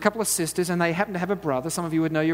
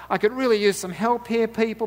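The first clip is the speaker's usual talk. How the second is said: fed-up mum; 7 semitones higher, 5 dB louder than usual. Retold or imitated. Mum in kitchen calling out.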